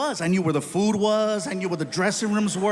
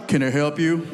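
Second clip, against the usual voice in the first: lower, louder gruff question; deep bodyguard; 5 semitones lower, 5 dB louder than usual. Retold or imitated. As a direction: tough bouncer blocking the door. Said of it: "And he's like".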